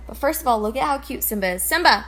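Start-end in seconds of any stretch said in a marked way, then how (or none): 1.6-2.1 loud, high call to the pet; calling the cat. Real, not acted. Calling the cat Simba, a loud bright call. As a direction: call out to get his attention.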